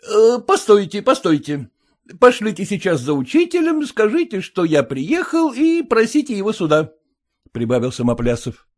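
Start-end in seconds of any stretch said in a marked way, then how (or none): 0.1-6.9 повыше, хозяин распоряжается; бойко командует прислугой; голос Самоплясова. Acted. (бодрый голос хозяина)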